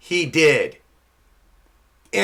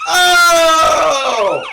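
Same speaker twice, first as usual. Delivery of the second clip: loud, high, held "oh" as a signal; long high wail; 12 semitones higher, 8 dB louder than usual. Pretend. Comic sounding the end of the show, long wail. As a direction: sustain a shouted, high-pitched alarm wail. Imitated signal ending the segment.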